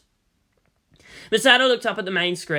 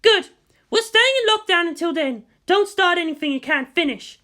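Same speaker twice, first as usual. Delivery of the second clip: higher, sharp, combative officer; Misato's voice; 13 semitones higher than usual. A narrator's reading. Misato snapping at the general, higher and defiant. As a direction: push back hard, voice pitched up.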